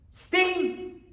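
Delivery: "Steam!" burst out high, an exclamation; exclaimed. Shown as a demonstration. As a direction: announce "Steam!" with sudden high excitement.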